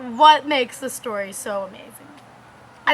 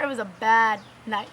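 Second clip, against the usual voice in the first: ordinary talk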